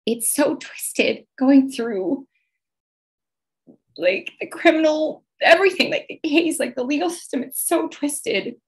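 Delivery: fighting back tears as she talks; getting emotional. Real, not acted. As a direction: voice rising high and tight, slowed, near tears. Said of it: "I'm gonna get emotional".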